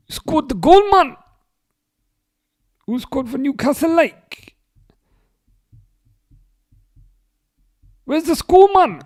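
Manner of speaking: high, loud Geordie shout at the screen; Geordie accent. Real, not acted. Streamer yelling at the match in Geordie accent. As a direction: bellow it in broad Geordie. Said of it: "descend into Geordie accent".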